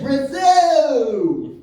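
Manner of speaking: long, high, loud bellow; drawn-out indignant holler; exasperated shout. Retold or imitated. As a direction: stretch the last syllable into a yell. Woman's final, longest shout.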